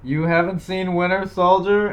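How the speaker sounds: loud, high outraged reading; all-caps shout. Acted. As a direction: read it out as if in capitals. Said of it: "In all caps".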